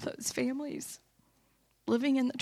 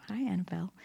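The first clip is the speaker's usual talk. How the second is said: whispered aside; 3 semitones lower, 7 dB quieter, much faster than usual. Real, not acted. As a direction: drop to a whisper to greet someone. Whispered aside to someone nearby.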